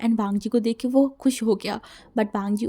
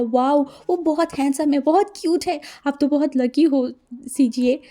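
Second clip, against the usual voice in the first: higher and louder, gushing praise; high, loud starry-eyed praise; 4 semitones higher, 5 dB louder than usual. Acted. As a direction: rave about him, thrilled. Wing gushes over handsome fiancé.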